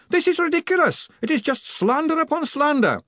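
high-pitched, protesting shout; indignant shouting. A narrator's reading. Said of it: "Greeningham shouted".

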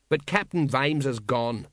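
ordinary delivery, nothing marked

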